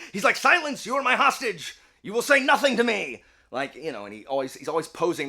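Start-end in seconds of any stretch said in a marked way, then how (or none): 0.3-3.2 high, loud, theatrical commands; hammed-up villain ordering a hostage about; mean, bossy Okabe. Okabe silences his hostage Mayuri, mean and grandiose. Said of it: "Okabe is mean to everybody".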